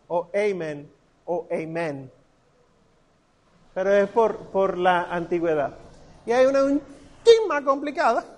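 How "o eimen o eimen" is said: AMEN said EY-men; English amen. Shown as a demonstration. Teacher quoting English variants for students, EY-men pronunciation. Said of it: "hasta en inglés tú escuchas".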